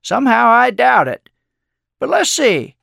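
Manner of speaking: high, loud mock doubt; sly old man stringing the boy along; playful skepticism, pitch up; teasing. A narrator's reading. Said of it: "teased with a sly look".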